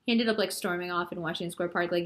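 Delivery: ordinary delivery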